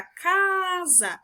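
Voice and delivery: drawn-out CASA, high CA falling into SA; calling the word. Shown as a demonstration. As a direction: call "casa" with a stretched first syllable. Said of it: "Se eu for chamar essa palavra".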